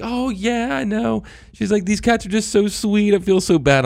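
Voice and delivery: imitating the kind neighbor lady, sympathetic; sweet neighbor. Retelling how the neighbor lady answered, high and sweet.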